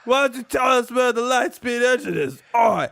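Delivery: loud hammed-up Cockney British accent, pitched high; mock Cockney villain. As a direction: bark it like a cartoon Cockney thug. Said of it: "they all have Cockney British accents".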